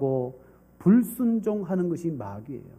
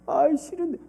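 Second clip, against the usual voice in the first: put-on reluctant child; 10 semitones higher than usual. Child refuses.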